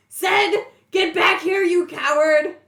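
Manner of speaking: high, very loud shout; yelling out. Acted. Fighter yelling to fleeing Zed, loud angry call. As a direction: holler after someone running away. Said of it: "I yell out".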